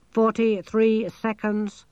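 high, slow monotone machine voice; talking machine character. A narrator's reading. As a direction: count it off evenly like a computer.

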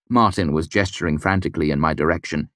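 ordinary delivery

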